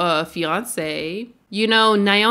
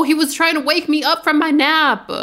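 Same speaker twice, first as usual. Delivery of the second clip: high, mildly whiny protest; indignant complaint; 7 semitones higher, 4 dB louder than usual. Real, not acted. Player complaining that the dog disturbed a nap, indignant. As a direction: raise the pitch in put-out realization.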